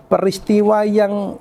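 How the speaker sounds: ordinary talk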